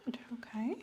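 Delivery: doctor whispering an aside to her patient; whispered aside. Acted. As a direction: whisper it under your breath. Doctor murmurs to herself.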